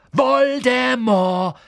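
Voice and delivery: loud, high, drawn-out bellow; slow full-voiced yell; roaring. A narrator's reading. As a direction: roar the name to shock the listener. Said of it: "brüllte Harry".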